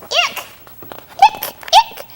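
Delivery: high, squeaky mock disgust; icky disgust. Reading to a child, high squeal of mock disgust. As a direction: squeal it like something gross.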